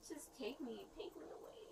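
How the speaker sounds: drained, breathy near-whisper, trailing away; defeated whisper. Real, not acted. Worn-out arrested woman asking the officer to take her, whispering. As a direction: give up and barely breathe it.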